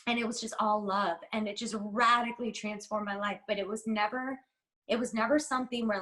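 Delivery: ordinary talk, nothing marked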